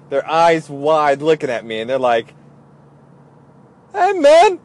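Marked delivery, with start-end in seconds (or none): 3.9-4.6 high, loud mock amazement; astonished. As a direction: burst out high and loud, imitating a stunned buddy. Stunned guys react to the card.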